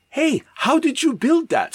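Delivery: high-pitched amazed question; comic astonishment. Acted. Verne seeing Wells's airship, high comic astonishment. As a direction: ask in a high put-on voice, taken aback.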